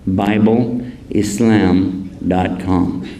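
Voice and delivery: very slow, word by word; slow dictation. Shown as a demonstration. Giving the crowd a website, very slow. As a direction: spell it out slowly for note-taking. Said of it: "dictates second address".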